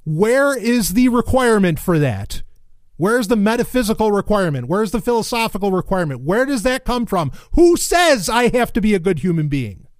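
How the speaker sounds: outraged challenge, high and forceful; indignant demand. Real, not acted. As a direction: hammer each question out, building to a shout. Angry rhetorical questions at the text.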